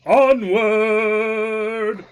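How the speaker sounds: loud, high, long-held battle cry; hammed-up charge call to drown out the chatter; rallying shout. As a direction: bellow it and hold the last syllable. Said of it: "So soothing".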